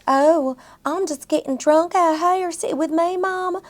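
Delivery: high, loose Southern belle drawl; drunk Southern belle. Retold or imitated. Podcaster mocks the drunk sister's accent, high Southern drawl. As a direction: play a tipsy Southern belle. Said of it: "She was just like".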